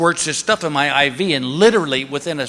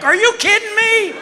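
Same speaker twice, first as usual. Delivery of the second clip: high-pitched comic outburst; indignant mock rant; 10 semitones higher than usual. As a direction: build to a high, incredulous yell. Acted indignant rant at doctor.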